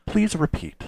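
high, soft automated assistant. Retold or imitated. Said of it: "it's an A I Siri".

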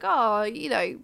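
ordinary delivery

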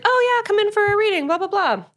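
high, quick mock sales pitch; breezy saleswoman. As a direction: rattle it off like a bored hostess. Psychic's daughter voiced.

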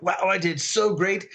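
high, self-satisfied mock boast; smug self-praise. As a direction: pat yourself on the back, pleased. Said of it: "and I'm like".

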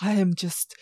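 a hint of a brooding emo vampire, soft and slow; mock emo lyric. Podcaster mocking the film's brooding vampire dialogue, softly and slowly. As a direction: read it like gloomy, overwrought lyrics. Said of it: "these are bad emo lyrics".

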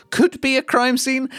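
high-pitched incredulous query. Real, not acted. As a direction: question it in squeaky disbelief. Mocking the script's wording.

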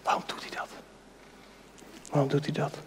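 near-silent, breathy question; hushed wonder. Real, not acted. Preacher wondering aloud to listeners, hushed. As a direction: ask it under your breath.

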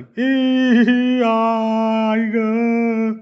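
high drawn-out mock wail; a hammed-up cry of pain. A narrator's reading. Mom wails about her cut foot, high and stretched out. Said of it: "पुन्हा थोड्या वरच्या पट्टीत रडू".

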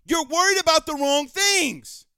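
fired-up, heavily punched scolding; angry rant. Real, not acted. Host berating the coach after a bad loss, angry rant.

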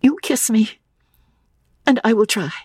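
put-on high, pleading young woman; earnest girlish plea; girl's voice. A narrator's reading. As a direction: ask it in a high, halting voice. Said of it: "said the girl".

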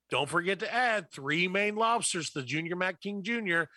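usual speaking voice

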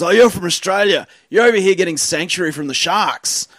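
high, loud mock voice; homeless man. Retelling the homeless man on the bus, high excited voice. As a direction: play him up as a loud excited stranger. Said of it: "He goes".

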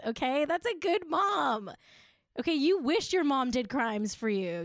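ordinary talk, nothing marked

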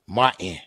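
Martin with the t dropped; low, offhand Martin with no t; nowadays pronunciation. Shown as a demonstration. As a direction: say Martin as "Mah-in", swallowing the t. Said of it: "That's how they say it nowadays".

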